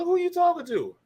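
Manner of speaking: very high incredulous voice; retold disbelief. Rookie answering the veteran who called him over, high-pitched disbelief. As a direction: squeak it out as a mock challenge.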